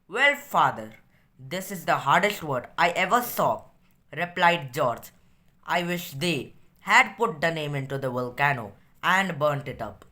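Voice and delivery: slow, measured read-aloud; deliberate classroom story reading. A narrator's reading. Reads story aloud in English.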